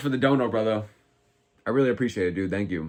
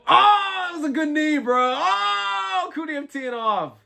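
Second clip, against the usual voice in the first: excited; 14 semitones higher, much slower than usual. Real, not acted. Streamer reacting to a knee, excited shout. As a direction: yell with the crowd at the knee.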